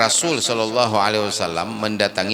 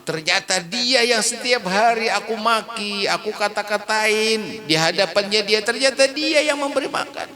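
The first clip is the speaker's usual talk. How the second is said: remorseful realization; 10 semitones higher than usual. Acted. Old man realizing he cursed his benefactor, high and anguished. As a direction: let the regret climb higher.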